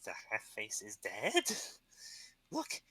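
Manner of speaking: a touch of goblin chief scorn; mocking the head, then calling him a dolt; lightly voiced scornful chief. Goblin chief scoffing at the hero's trophy, lightly voiced.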